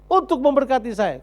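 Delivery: high, loud self-righteous demand; demanding son. Acted. Elder son to father, loudly claiming his reward. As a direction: insist on what you're owed. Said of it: "Jadi waktu dia berkata ini".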